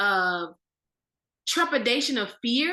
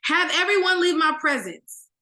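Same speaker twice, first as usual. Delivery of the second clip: loud, higher cry of command; 7 semitones higher, 5 dB louder than usual. Acted. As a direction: shout it out so everyone must leave. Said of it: "and he cried out".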